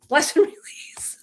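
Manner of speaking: slow whispered send-off with laughter; mock serene blessing. Sending off those who are offended, whispered mock blessing. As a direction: whisper it slowly, letting a laugh in. Playful blessing after namaste.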